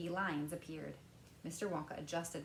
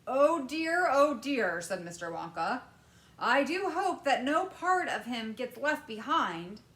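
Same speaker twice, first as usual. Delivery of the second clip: louder, higher worried candymaker; lightly voiced fretting Wonka; 6 semitones higher, 10 dB louder than usual. A narrator's reading. Wonka worrying over the boy, louder. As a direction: fret aloud.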